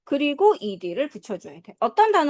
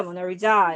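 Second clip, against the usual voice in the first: "모나리자"의 "자"에 강세; "모나리자"의 마지막 "자"를 힘주어; 끝음절 강세; 5 semitones lower than usual. Shown as a demonstration. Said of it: "이렇게 연결해서 할 수 있겠어". ("모나리자"의 "자"를 세게)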